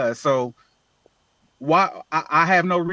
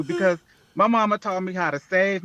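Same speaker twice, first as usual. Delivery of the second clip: normal talking voice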